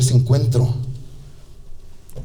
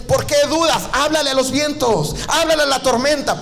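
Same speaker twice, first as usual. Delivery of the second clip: high urging; 10 semitones higher than usual. Acted. Jesus challenges disciples.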